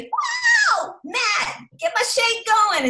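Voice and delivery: shrieking high and loud with glee; mock excited shout. Melanie on her stories calling to Matt, shrieking with excitement. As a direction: belt it out like a hyped-up cheerleader. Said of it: "the first story you see is".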